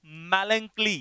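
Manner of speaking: "मेलंकली" said slowly and clearly as MAL-an-klee; "मेलंकली" in three careful syllables, falling at the end; pronunciation demo. Shown as a demonstration. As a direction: model the word "मेलंकली" for learners, first syllable drawn out. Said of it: "इसका pronunciation क्या है".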